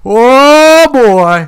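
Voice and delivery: high, loud drawn-out mock cry; hammed-up Boston guy whooping; mock Boston streamer. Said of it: "he's talking like an idiot".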